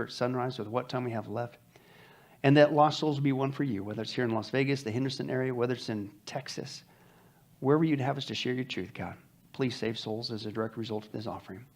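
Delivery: soft, unhurried prayer voice; hushed pastoral prayer over the offering; earnest closing prayer. Acted. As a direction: pray it quietly to God on behalf of the church.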